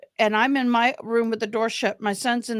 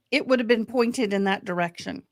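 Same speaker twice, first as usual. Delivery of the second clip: ordinary talk, nothing marked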